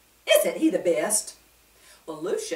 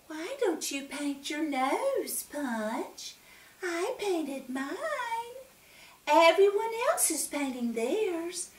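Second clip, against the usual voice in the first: sweet coaxing; 9 semitones higher, slower than usual. A narrator's reading. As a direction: tempt him gently. Twiggy coaxing Punch.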